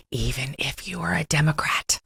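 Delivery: hushed, unvoiced aside; mock-confiding whisper. Host lets anchor in on a secret, mock whisper.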